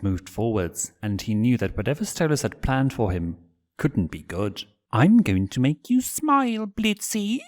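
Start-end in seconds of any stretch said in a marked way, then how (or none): 4.9-7.4 much higher, playful menace; teasing. Acted. Stolas cornering Blitz to tickle him, high and teasing. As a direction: tease him like a predator. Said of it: "the owl teased".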